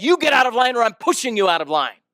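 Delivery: high, forceful angry threat; retold yell. Angry man threatening to push the woman out, yelling. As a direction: yell a warning at a queue jumper. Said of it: "he yells again at the back of her head".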